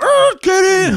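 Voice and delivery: imitated getaway driver hollering; hammed-up urgent call from a truck; urgent shout. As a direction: screech up and yell, high and loud. Friend pulls up in getaway truck.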